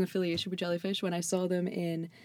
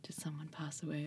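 whisper; 3 semitones lower, 17 dB quieter than usual. Retold or imitated. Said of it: "they're always like".

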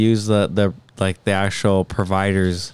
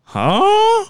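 very high, rising "huh?"; exaggerated falsetto confusion; mock bafflement. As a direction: squeak it up in puzzlement. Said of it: "That's why I'm looking like".

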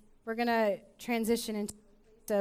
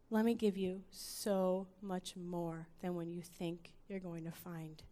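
soft, hushed sermon voice falling away; quiet preaching; 10 dB quieter than usual. Acted. Quiet reflective sermon passage.

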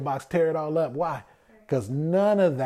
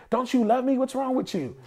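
much higher, whiny accusing questions; mock hurt partner; 9 semitones higher than usual. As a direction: imitate a hurt partner demanding love. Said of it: "and say".

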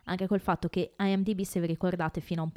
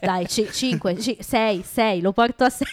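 laughing through the words; giggling as she gives in; 4 semitones higher, 6 dB louder than usual. Real, not acted. Raising her vote while laughing.

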